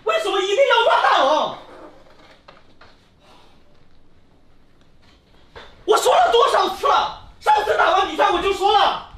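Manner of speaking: furious shouting at players; loud sharp voice. Real, not acted. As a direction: yell at the team in real anger. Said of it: "react pretty intensely towards his players".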